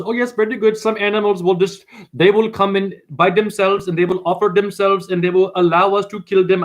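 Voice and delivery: imitated priest with an Indian accent; mock Indian accent. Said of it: "they have the very good accent".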